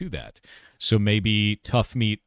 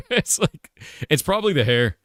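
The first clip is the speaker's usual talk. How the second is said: high-pitched words breaking out of a laugh; genuinely cracking up mid-sentence; 8 semitones higher than usual. Real, not acted. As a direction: talk through helpless giggles. Breaks up laughing while talking.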